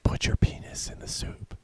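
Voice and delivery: teasing whisper, no voice. Acted. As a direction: breathe it as a naughty secret. Teasing aside during the game.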